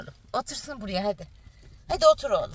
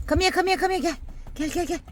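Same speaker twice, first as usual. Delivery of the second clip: high calling to dog; 9 semitones higher than usual. Real, not acted.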